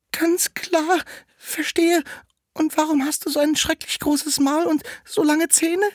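put-on high-pitched little-girl voice; Rotkäppchen questioning. Rotkäppchen to the disguised wolf in bed, high questioning voice. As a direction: ask in a high, put-on child voice.

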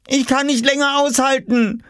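high-pitched, urgent cry; desperate wail. A narrator's reading. Oskar bursting for the toilet, wailing desperately.

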